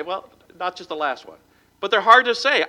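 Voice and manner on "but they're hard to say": "hard" punched high and loud; stress on hard. Shown as a demonstration. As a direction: drive the word "hard" home.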